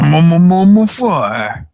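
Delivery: high, loud mocking kid voice. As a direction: ham up a silly kid voice. Mocking friend's imitation.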